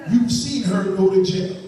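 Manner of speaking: movie trailer voice. Pretend. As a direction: sell it like a trailer. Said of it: "I see the trailer now".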